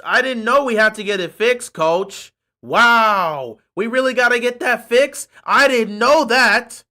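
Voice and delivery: loud, sarcastic disbelief; exaggerated fake ignorance; mock surprise. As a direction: play astonished at the obvious. Feigned ignorance mocking the coach.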